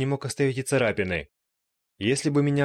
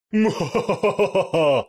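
stifled laugh; 9 semitones higher, 6 dB louder than usual. Acted. Laugh after condolences.

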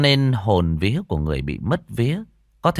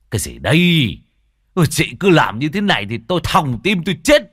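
loud startled outburst, climbing high; jump, then complain loudly about the fright; 6 semitones higher, 6 dB louder than usual. A narrator's reading. Old Tân tapped on the shoulder, startled and protesting loudly. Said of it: "Lão giật mình".

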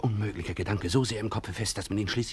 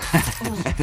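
short exultant laugh; triumphant laugh; 8 semitones higher, 4 dB louder than usual. Acted. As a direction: laugh out loud at the win.

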